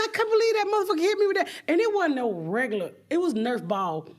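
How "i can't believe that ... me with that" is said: high, racing complaint; outraged disbelief. Retold or imitated. Comic after being hit by Nerf ball, outraged disbelief. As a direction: rush it out high in mock outrage.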